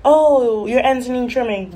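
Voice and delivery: mock stylist voice; pushy stylist. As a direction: nag like a salon worker. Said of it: "every time I go to the salon".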